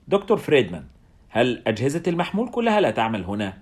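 usual speaking voice

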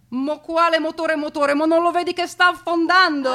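high, alarmed dialect voice; panicked southern passenger. Acted. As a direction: cut him off in rising panic.

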